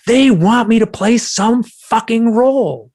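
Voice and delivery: loud, high, drawn-out outrage; livid. Retold or imitated. Man under hypnosis answering his questioner, livid. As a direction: rage at it, stretching every word. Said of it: "I was livid, angry".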